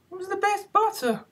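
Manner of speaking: very high, meek little voice; high timid hare voice; meek March Hare. A narrator's reading. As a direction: squeak it out meekly, very high. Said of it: "the March Hare meekly replied".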